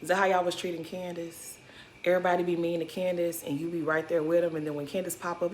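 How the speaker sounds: usual speaking voice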